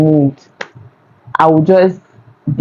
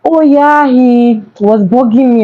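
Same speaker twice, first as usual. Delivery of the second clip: indignant retort; 7 semitones higher than usual. Retold or imitated. Defending herself to friend.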